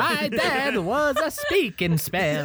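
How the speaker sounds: slow, high electronic toy voice; talking-toy voice. Acted. Child of a Speak and Spell, slow toy voice. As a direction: drone slowly like a high-pitched speaking toy.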